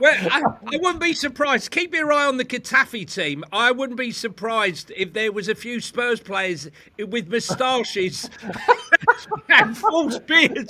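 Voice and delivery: laughing through the words, voice high and loud; can barely get it out for laughing. Real, not acted. Pundit laughing as he pictures disguised Spurs players.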